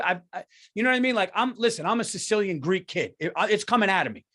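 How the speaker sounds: usual speaking voice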